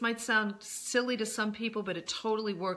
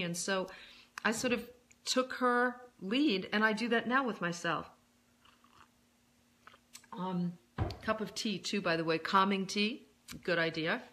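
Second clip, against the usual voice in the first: normal talking voice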